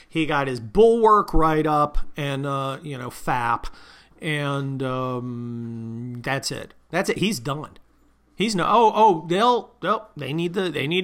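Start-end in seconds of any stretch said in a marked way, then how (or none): none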